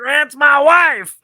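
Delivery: high, slow squawking parrot. Acted. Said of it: "that parrot impression is my favorite".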